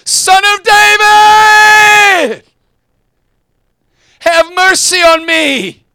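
long wailing plea to be heard over a crowd; desperate shout. Retold or imitated. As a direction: beg at the top of your lungs from the roadside. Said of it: "Faith equals asking".

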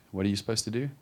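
normal talking voice

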